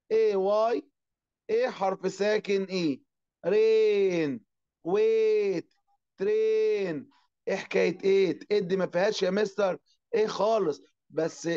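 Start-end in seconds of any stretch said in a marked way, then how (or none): 3.5-7.1 رين، ويت، ترين, each with a drawn-out "ay"; long A vowel. Shown as a demonstration. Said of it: "أنا بمد في الإيه". (مدّ الإيه في «رين، ويت، ترين»)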